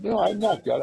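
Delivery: loud, high-pitched mock denial; acted protest. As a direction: imitate a startled caller objecting loudly. Acts caller protesting being called God.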